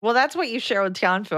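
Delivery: ordinary talk, nothing marked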